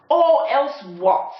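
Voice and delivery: high, loud, slow defiant snarl. Acted. Zar defying the dingo, loud snarl. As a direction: dare him to try. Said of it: "snarled Zar".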